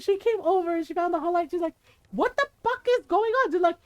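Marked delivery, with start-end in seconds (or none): 2.1-3.5 high-pitched angry mom imitation; exasperated yell. Mother finding the hall light, exasperated. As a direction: demand to know what all the noise is. Mother confronts screaming children.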